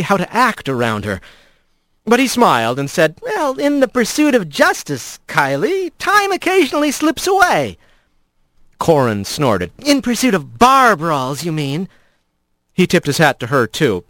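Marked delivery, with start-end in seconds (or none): 3.2-7.8 high, pompous gallant; grandiose excuse. Acted. Rat's grand excuse to Kylie. 9.8-11.9 lightly voiced dry scorn; sarcastic jab. Corin mocks Rat.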